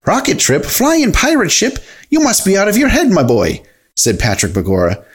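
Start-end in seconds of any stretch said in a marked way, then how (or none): 0.1-3.6 high-pitched disbelief, falling at the end; incredulous scoffing. Acted. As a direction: scoff at a mad idea. Patrick scoffs at the plans.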